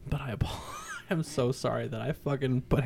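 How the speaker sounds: softly whispered sorry with a laugh; whispered apology. Real, not acted. Whispering apology to the kid he roughed up.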